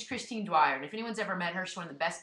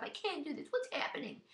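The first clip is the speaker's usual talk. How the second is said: higher, softer, faster mock whine; mock whiny voice; 9 semitones higher, 6 dB quieter than usual. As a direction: imitate a whiner, pitched up and hurried. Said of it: "I'm gonna stop whining".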